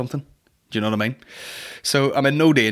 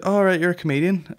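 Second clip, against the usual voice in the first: woman realising; 8 semitones higher than usual. Retold or imitated. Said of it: "And she goes".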